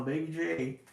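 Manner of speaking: usual speaking voice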